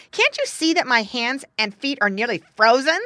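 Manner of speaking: loud, high indignant question; angry retort. A narrator's reading. Freezing daughter to King Frost, angry complaint. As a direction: complain furiously at full volume. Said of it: "she answered angrily".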